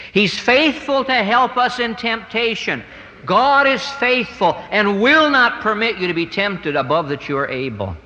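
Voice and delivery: rising, ringing preacher's proclamation; sermon climax, voice lifted high and strong; preaching at full force. Acted. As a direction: build it up line by line from the pulpit. Preaching on Christ's faithfulness.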